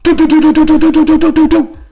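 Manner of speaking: rapid high "doo doo doo" like shots going off; mouth-sound machine gun burst; imitated gunfire. Imitating gunfire in movie scene.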